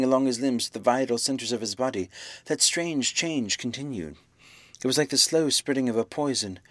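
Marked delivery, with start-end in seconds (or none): none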